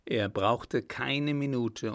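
ordinary delivery, nothing marked

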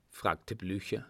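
usual speaking voice

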